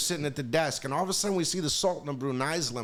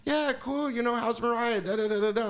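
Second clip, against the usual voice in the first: Michael's voice; 7 semitones higher than usual. Retold or imitated. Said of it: "and he goes".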